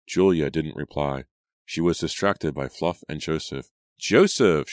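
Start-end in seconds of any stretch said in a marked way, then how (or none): 4.0-4.7 loud call across the lawn. A narrator's reading. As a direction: call his name out loud. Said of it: "she called".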